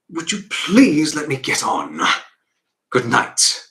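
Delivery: loud, slow, stretched plea to be left alone; heavy, exasperated brush-off; exasperated dismissal. Acted. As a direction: lean hard and loud on each word to send the child off. Father dismisses child.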